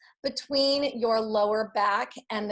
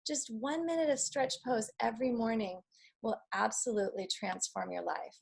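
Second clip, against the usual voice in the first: guided relaxation; 4 dB quieter than usual. Acted. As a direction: ease them down gently, softer. Guides relaxation after pose.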